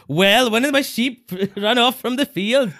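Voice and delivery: put-on high, loud Welsh accent, breaking into laughter; trying to sound Welsh. Retelling the excuse to the bobbies, laughing mock-Welsh accent. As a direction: put on a Welsh local, giggling midway. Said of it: "try and sound like a local".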